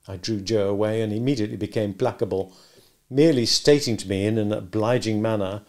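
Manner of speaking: ordinary delivery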